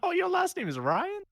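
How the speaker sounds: high, mock-astonished question; sarcastic surprise, voice jumping high. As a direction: act stunned at the name. Mock surprise at character name.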